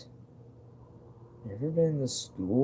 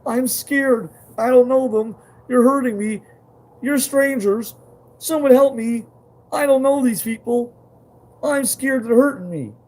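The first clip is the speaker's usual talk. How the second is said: put-on high, loud frightened child pleading; scared little child; 14 dB louder than usual. Showing viewers his inner reaction, a high frightened child pleading. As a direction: beg like a small child being hurt by strangers. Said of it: "It was".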